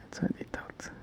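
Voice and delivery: soft whisper under breath. Real, not acted. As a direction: whisper it to yourself. Whispers to himself while working.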